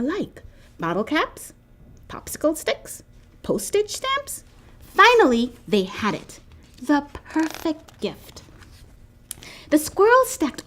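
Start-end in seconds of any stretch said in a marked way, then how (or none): none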